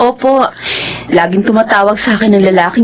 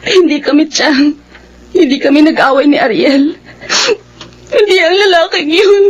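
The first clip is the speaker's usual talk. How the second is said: high, crying voice, words breaking; tearful distress; 11 semitones higher than usual. Acted. Niece tells her aunt she saw her ex, crying. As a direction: fight through the sobs to get the words out.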